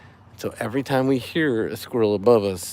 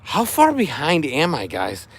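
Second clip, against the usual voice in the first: ordinary delivery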